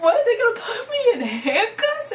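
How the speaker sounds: high, drawn-out mock wail; freaking out. Teen fearing handcuffs, hammed-up freaking out. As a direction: act out comic panic, slowly wailing. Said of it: "freaking out, like, really freaking out".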